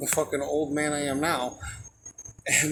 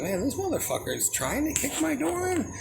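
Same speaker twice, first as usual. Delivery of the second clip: outraged; 11 semitones higher than usual. Retold or imitated. Door kicked in, retold outrage.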